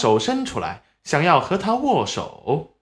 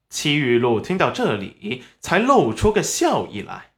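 ordinary delivery, nothing marked

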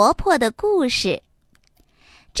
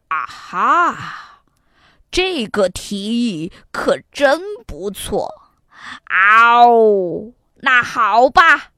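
tiger voice; 3 dB louder, slower than usual. Acted. Tiger accepts, roaring.

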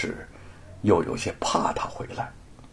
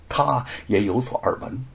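usual speaking voice

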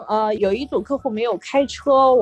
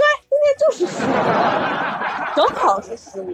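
laughing; 6 semitones higher than usual. Retold or imitated. Excitedly pointing out full moon.

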